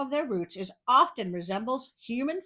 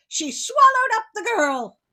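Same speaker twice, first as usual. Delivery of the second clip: dramatic cry; 14 semitones higher, 11 dB louder than usual. A narrator's reading. Dramatic story climax, cry.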